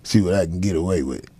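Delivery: low, soft sly mutter of a troublemaker; lightly voiced sneaky heckler testing him. Comedian voicing a heckler plotting a dig, low sly mutter. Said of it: "People just automatically be like".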